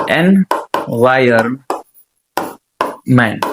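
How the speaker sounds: Environment very slowly in three separated pieces; sounded out. Shown as a demonstration. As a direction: dictate environment with long gaps, "en... viron... ment". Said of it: "એન્વાયરમેન્ટને લખાય આ રીતે". (Environment ટુકડા પાડીને, ખૂબ ધીમેથી)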